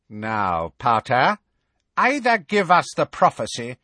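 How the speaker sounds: threaten, savouring it; menacing witch. A narrator's reading. Bellatrix threatening Harry.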